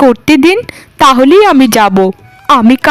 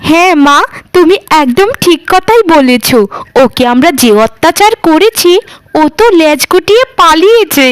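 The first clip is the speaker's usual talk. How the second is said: ordinary talk, nothing marked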